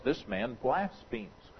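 whispering among themselves. A narrator's reading. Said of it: "a little whispering that goes on".

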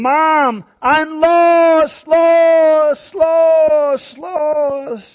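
high, loud scream calling for mother; lost child shouting for mom. Acted. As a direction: cry out to your mother in panic. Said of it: "You scream".

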